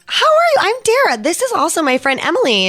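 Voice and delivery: eager fan meets celebrity; gushing intro. Retold or imitated. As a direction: introduce yourself to a legend, over the top. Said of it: "I'm like".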